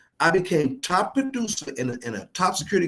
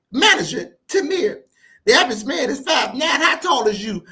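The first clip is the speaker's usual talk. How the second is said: high-pitched mock lady voice; imitated taunting woman; 8 semitones higher, 4 dB louder than usual. Woman at the gym trying to get the short coach, imitated taunt. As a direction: call it out high, needling him. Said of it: "She tried to really get me".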